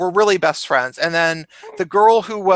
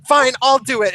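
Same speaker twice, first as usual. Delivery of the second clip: higher, firm fed-up take-charge line; mock exasperated; 7 semitones higher, 4 dB louder than usual. Chubby friend pushing others aside, mock exasperated. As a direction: huff and step up.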